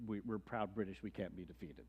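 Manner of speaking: lightly voiced haughty British soldier; mock proud British officer. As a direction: puff up as a smug redcoat sure of victory. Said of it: "They were".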